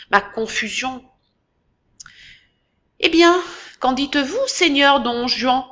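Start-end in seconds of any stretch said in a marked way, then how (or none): 3.0-5.6 high, louder mocking sneer. A narrator's reading. Sub-prefect taunting the accused boy, sneering. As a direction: sneer the question at him, drawing out the end. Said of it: "ricana le sous-préfet".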